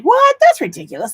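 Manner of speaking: high, loud burst of disbelief; retold shock, hammed up; incredulous. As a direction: shoot up high on a disbelieving outburst. Acting out her own disbelief.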